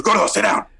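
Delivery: lightly voiced firm Guillermo; Guillermo's command. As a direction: order him to sit in Guillermo's voice. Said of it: "he goes".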